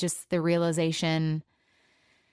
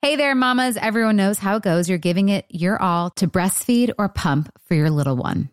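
louder, cheerful commercial voice with a quick disclaimer; ad read; 6 dB louder than usual. Acted. Host selling herbal tea to breastfeeding moms, upbeat ad read. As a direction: pitch the product to new moms, friendly and bright.

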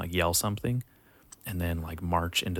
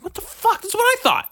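very high, louder disbelieving cry; shocked squeal; 7 dB louder than usual. Retold or imitated. Recruit hearing camp is like juvie, shrieking disbelief. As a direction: shriek it in mock horror.

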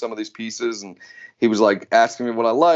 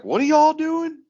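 much higher-pitched imitation of a puzzled brother; curious brother; 3 dB louder than usual. Quoting brother asking about Maker Camp, high and curious. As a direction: pitch way up, mock puzzled question.

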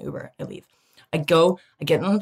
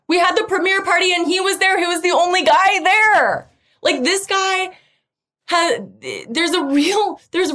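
excited gushing; 7 semitones higher than usual. Real, not acted. Excited telling about him.

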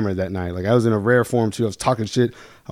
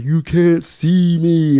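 higher, very drawn-out taunt; taunting kid; 5 semitones higher, 3 dB louder, much slower than usual. Retold or imitated. Little kid waving at the tower gunner, teasing. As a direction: imitate a kid stretching out a teasing catchphrase. Mimics kid's waving wrestler catchphrase.

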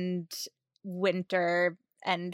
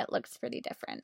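whispered aside; 9 semitones lower, 11 dB quieter, much faster than usual. Real, not acted. Trailing aside.

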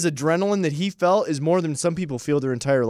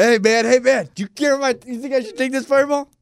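high-pitched pestering; mock eager asking; 8 semitones higher than usual. Re-enacting a co-host asking a stranger about the shot, mock eagerness. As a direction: pipe up, badgering a stranger for approval.

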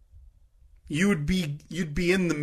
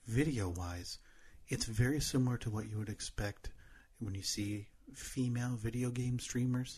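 ASMR whisper; 5 semitones lower, 13 dB quieter than usual. Pretend. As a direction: keep the hushed ASMR voice going. Keeps ASMR voice for a bit.

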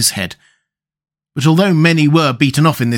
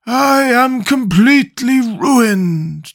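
slow, higher, mournful Scarecrow voice; ruined Scarecrow; 10 semitones higher, much slower than usual. Acted. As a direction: stretch out the despair. Scarecrow laments.

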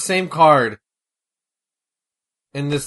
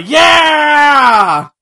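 loud excited yell; shout; 6 semitones higher, 7 dB louder than usual. Real, not acted. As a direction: whoop it up for the crowd. Calling out drop kicks.

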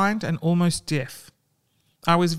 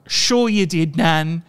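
mock dismissive; 4 dB louder, slower than usual. Mocking how people answer old folks.

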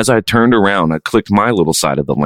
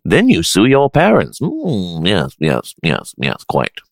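mustache guy. Pretend. Co-host approving the Twitter scheme, mock mustache-guy voice. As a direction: play a mustache-stroking character, murmuring the yeses. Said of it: "I like my mustache guy".

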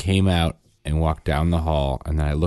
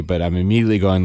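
ordinary talk, nothing marked

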